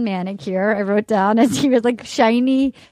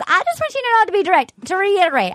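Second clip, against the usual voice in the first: higher, mock-earnest voice; imitating an earnest contestant; 6 semitones higher, faster than usual. Recapping her confession to him, high mock earnestness. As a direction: pitch it up, playing painfully direct. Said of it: "And then she goes".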